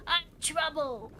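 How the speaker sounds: a hint of a scrappy gremlin claiming his name. Shown as a demonstration. DM showing viewers her character Hank, a light gremlin voice. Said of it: "Hank talks like this".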